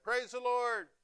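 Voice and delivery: high, loud cry of praise; shouted praise. Retold or imitated. Old lady on her winter porch, high loud shout of praise. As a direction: fling it up to heaven.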